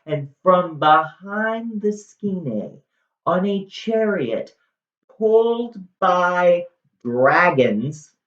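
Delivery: high, drawn-out grand reveal; dramatic narration. A narrator's reading. Storyteller reveals the dragon chariot, high dramatic narration. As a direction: announce it slowly and high, savoring each phrase.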